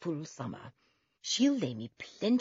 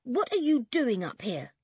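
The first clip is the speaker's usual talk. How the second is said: raised, louder scolding; stern telling-off; stern older brother; 6 semitones higher, 5 dB louder than usual. Acted. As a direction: demand it sternly of a little brother. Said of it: "said Toby sternly".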